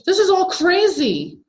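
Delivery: high, loud, slow cry of panic; frantic. A narrator's reading. Jessica fleeing up the stairs, panicked cry. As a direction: cry out while running away.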